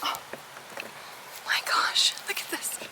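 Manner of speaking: hushed whisper of surprise; soft secret whisper over a find. Real, not acted. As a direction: breathe it out so nothing is startled. Hushed discovery in garden.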